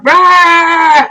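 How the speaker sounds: short loud frustrated rah; a burst of pent-up anger sound; mock rage growl. Inner outburst growl.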